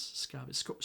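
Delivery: very soft, halting false starts; mumbling to himself. Acted. Gorath working out what he heard, halting soft mumble. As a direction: feel out the word under your breath. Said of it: "Gorath was mumbling to himself".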